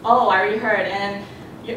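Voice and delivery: ordinary talk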